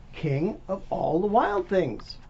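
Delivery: plain reading voice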